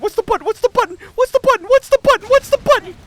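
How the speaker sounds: shrill, loud, rising panic; frantic shouting. Real, not acted. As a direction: yell it over and over, frantic. Repeated frantic shouting at game.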